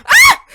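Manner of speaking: sudden piercing scream of fright; startled scream. Real, not acted. Startled by the jumpscare, real piercing scream. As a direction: shriek at the jumpscare.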